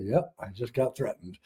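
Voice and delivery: deadpan. Retold or imitated. His flat reply to crying wife.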